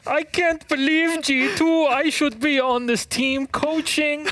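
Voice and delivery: high, slow, hammed-up Norwegian accent; mock a Norwegian coach in a bad Norwegian accent. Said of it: "That's my best accent".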